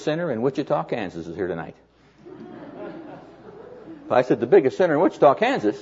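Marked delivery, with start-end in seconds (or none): 4.4-5.8 retold disbelief, pitched up to a high questioning end; incredulous echo. Preacher questioning the man's news about the sinner, incredulous echo.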